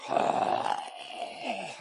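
long breathy reluctant sigh; weary sigh. Pretend. Waking up for work, weary sigh. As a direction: sigh at another workday. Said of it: "wake up and go".

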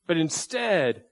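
ordinary talk, nothing marked

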